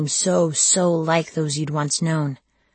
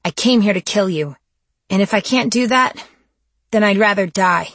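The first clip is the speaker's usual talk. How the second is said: louder, defiant declaration; 4 semitones higher, 6 dB louder than usual. Acted. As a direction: stand firm and declare it. Defiant captive to her captor.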